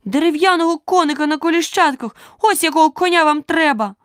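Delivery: high, loud indignant scolding; out of patience. A narrator's reading. Alice scolds the knight about his horse, exasperated. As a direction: let it fly, pitch held way up. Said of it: "Алісин терпець урвався остаточно".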